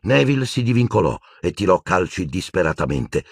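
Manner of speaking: usual speaking voice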